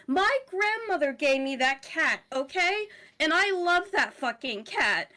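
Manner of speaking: high, loud, indignant rant; outraged pet owner. Acted. Cat owner berating caretaker for losing her cat, outraged.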